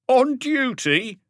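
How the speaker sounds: high, loud and slow, dripping with scorn; mocking shout. A narrator's reading. A reporter in the crowd jeering at Maigret, mocking shout. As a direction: yell it back to ridicule him. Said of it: "shouted a mocking voice".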